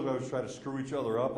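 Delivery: ordinary talk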